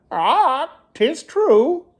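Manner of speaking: high squawking parrot cry; the parrot calls out the next rascal, squawking. Acted. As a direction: screech it like a parrot. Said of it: "squawked the bird".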